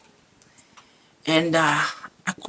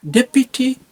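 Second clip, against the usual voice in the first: normal talking voice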